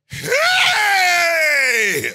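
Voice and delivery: long, high screamed heys; warfare shout. Shown as a demonstration. Wife rebukes the strange woman in prayer, full-force shout.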